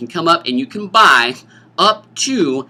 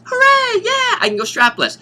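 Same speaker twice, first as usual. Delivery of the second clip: mock cheer; 4 dB louder than usual. Girl cheering about strapless dresses.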